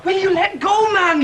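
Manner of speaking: high, loud shout to be let go; shouting in pain at his tormentor. Acted. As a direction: yell at him to release you. Pinned in a fight.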